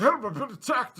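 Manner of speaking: high, loud mock panic; frantic cry for help. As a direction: act out a panicked emergency call. Retold frantic call to police.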